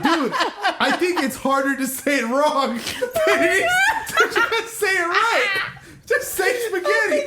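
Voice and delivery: high, slow, cracking up while pleading; half-sobbing with laughter; laughing through words. Retold or imitated. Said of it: "I said".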